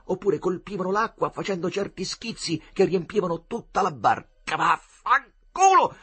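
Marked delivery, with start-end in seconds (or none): 4.6-6.0 loud, high, drawn-out curse; indignant shout. Acted. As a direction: spit it out outraged. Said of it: "gridava il Riccetto indignato". (urlo indignato)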